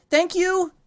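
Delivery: put-on high, loud child's shout; yell over shoulder. A narrator's reading. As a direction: call back loudly while running off. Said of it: "you yell over your shoulder".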